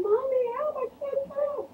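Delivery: put-on high, pleading call for mom, childlike; stuck child calling her mother for help, panicked and high; retold panicked cry. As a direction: plead for help like a trapped scared kid. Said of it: "I'm crying".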